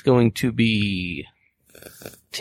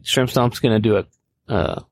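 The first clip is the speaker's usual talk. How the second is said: ordinary talk, nothing marked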